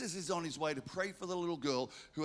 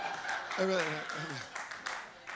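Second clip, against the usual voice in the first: laugh. Real, not acted. Pastor laughs at his own name-tag joke, hearty. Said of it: "laughs at own joke".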